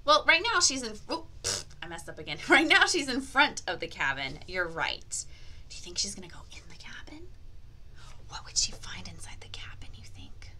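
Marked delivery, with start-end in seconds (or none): 5.7-10.5 quick, soft whisper; whispered suspense. Acted. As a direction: hush it like a secret.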